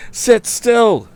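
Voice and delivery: very high urgent shout. Acted. Tom shouting to the frightened woman, high urgent shout. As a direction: shout it over the hoofbeats. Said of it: "he shouted".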